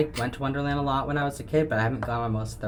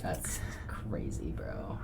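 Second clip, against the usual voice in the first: sarcastic deadpan; 4 semitones lower, 10 dB quieter, much slower than usual. Shown as a demonstration. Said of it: "This sounds so sarcastic".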